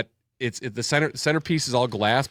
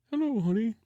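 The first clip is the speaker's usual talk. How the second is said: higher, softer, creepy mock-sweet; Ed Gein voice; 8 semitones higher, 7 dB quieter than usual. Through the bathroom door to his wife, creepy soft voice. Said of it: "with that voice going".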